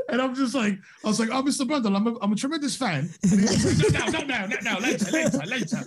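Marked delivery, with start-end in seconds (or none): 1.3-3.1 higher-pitched mock British fanboy; fake British accent. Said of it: "the fake British accent". 4.1-5.8 high-pitched mock brush-off, repeated fussily; Martin Brundle. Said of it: "like, shooed me away".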